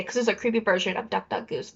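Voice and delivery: usual speaking voice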